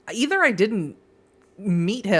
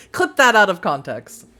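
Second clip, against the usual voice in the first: louder, laughing jest; 3 semitones higher, 6 dB louder than usual. Real, not acted. Streamer joking to viewers about clips, laughing. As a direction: say it through giggles at your joke.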